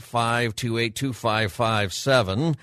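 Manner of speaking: ordinary talk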